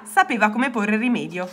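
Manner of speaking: ordinary delivery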